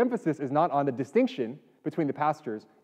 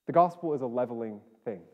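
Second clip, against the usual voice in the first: ordinary delivery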